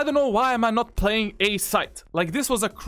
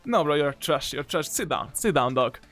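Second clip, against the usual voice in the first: dismissive; 6 semitones lower than usual. Acted. Trash talk after mocking.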